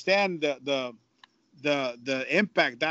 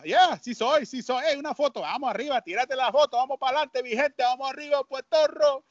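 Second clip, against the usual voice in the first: higher, hammed-up hype for the crowd; hyped-up showman; 9 semitones higher than usual. Broadcaster posing for photos with Puerto Rican fans, hammed-up hype. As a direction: play the big star rallying the fans.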